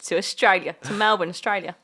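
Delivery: put-on botched Australian accent. Host signing off the show for Melbourne, botched Aussie accent. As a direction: attempt a jokey Australian accent, landing near Cockney. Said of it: "Why did you do a Cockney accent?".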